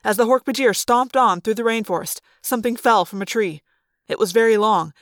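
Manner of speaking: ordinary delivery, nothing marked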